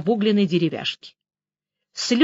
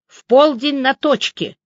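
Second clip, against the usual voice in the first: raised, emphatic order; curt; 5 semitones higher, 5 dB louder than usual. A narrator's reading. Major setting a meeting before hanging up, curt. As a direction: lay it down slowly and firmly. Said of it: "коротко ответил майор".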